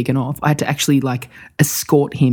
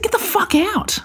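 high, strained mock yell; retold furious order. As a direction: act out throwing someone out of the room. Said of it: "I'm furious".

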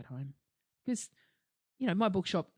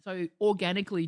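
ordinary delivery

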